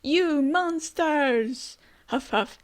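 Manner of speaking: high, drawn-out cry of delight; Luffy's outburst. Retold or imitated. Reading Luffy greeting his friends, high drawn-out cry. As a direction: stretch out a joyful yell at his friends. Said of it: "And Luffy like".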